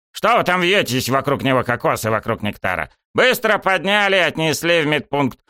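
high-pitched order to the players; brisk command. A narrator's reading. Coach to crowding players, brisk command.

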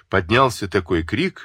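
plain reading voice

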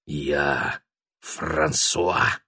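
очень медленно, тихо, зловеще; тянет каждое слово; sinister whisper. A narrator's reading. Продолжает пугать дядю. (зловещий шёпот)

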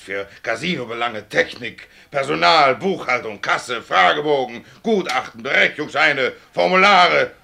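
ordinary talk

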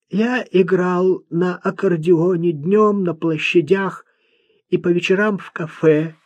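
high-pitched, laboured recital of points; odd, earnest young man. A narrator's reading. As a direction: voice a simple lad trying to speak properly.

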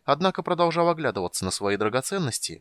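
ordinary delivery

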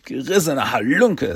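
ordinary delivery, nothing marked

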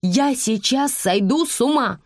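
high, desperate woman's outburst; frazzled mother, pitch climbing high; exasperated cry. A narrator's reading. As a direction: cry out at your wits' end. Mother driven mad by singing.

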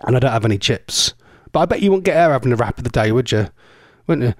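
normal talking voice

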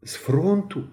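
shaky, high, startled question; Mishko's breath catches at the news; trembling voice. A narrator's reading. Boy hears his friend leaves for the front, voice trembling. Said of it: "Мишків голос затремтів".

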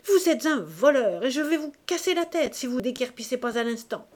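enraged magistrate threatening to break the lover's head; furious shout. A narrator's reading. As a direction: yell the accusation, then rush the threat. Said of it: "Delcambre cria".

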